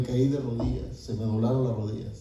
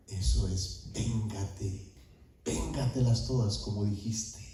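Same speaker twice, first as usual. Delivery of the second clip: low, hushed voice egging him on; inner tempting voice; 5 semitones lower, 6 dB quieter, slower than usual. Retold or imitated. Voice heard mid-spanking urges revenge, low and hushed. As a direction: drop low and soft, a sinister urging. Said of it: "escucho una voz aquí que me dice".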